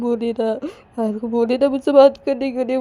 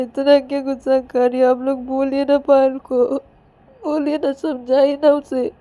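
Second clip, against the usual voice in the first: high, louder crying complaint with stammered repeats; acted tearful pleading; 6 semitones higher, 5 dB louder than usual. Ananya begging mothers to talk Payal round, tearful. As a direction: plead through sobs, whining to the grown-ups. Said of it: "रोए जा रही थी बहुत ही।".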